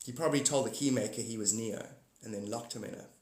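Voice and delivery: ordinary talk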